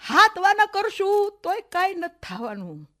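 slow, high, loud declaration; emphatic warning. Acted. Aunt warning her niece from the threshold, emphatic. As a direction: stretch the words out with force. Aunt's bitter parting warning.

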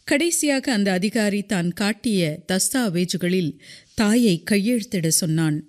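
ordinary delivery, nothing marked

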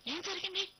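high, loud alarmed cry; frightened parent calling out; anxious parents. A narrator's reading. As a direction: ask in sudden alarm, voice shooting up. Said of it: "పరిగెత్తుకొచ్చారు ఆదుర్దాగా".

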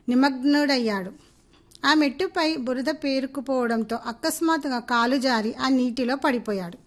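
ordinary delivery

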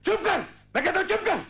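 very high-pitched angry outburst; furious silencing. Acted. Husband cutting off his wife's accusation, furious yell. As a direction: cut her off, voice shooting right up.

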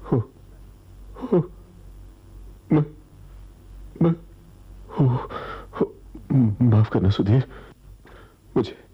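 halting, fearful stammer in broken bits; fearful, disoriented gasps of words; frightened. Acted. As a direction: stammer out an apology, scared and shaken. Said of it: "क्यों डर रहे हो".

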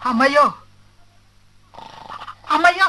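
loud, scared wailing calls to brother; frightened cries. Acted. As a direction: cry out in fright at the noise up in the loft. Fright at something in the loft. (భయంతో అరుపులు)

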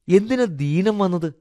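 put-on high, slow child's voice; high-pitched childlike question; curious little boy. A narrator's reading. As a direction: ask like a puzzled small boy.

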